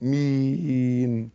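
آمين with a slow, softly held ee; measured amen. Shown as a demonstration. Teacher modelling amen, آمين with slow soft ending. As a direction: draw out the end of آمين softly. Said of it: "حركتين".

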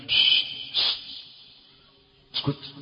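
soft unvoiced shush; hushing. Preacher hushing the congregation to listen, soft. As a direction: hush them into silence. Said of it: "preacher hushes the congregation".

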